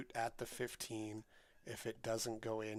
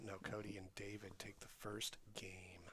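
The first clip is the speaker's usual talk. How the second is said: hushed whisper; 2 semitones lower, 11 dB quieter than usual. Real, not acted. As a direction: whisper the update. Commentating quietly on stream.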